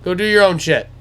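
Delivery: high, loud send-off to deer; imitating dad calling to animals. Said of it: "And he goes".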